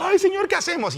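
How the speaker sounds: high-pitched, hammed-up wail; mock crying. Moses pleading with God at the sea, mock crying. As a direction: plead helplessly in an imitated cry, very high. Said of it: "Porque Moisés estaba llorando".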